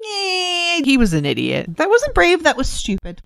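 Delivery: high, sneering mock voice; dismissive Smerdyakov. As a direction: scoff at the martyr like a smug know-it-all. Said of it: "Smerdyakov is like".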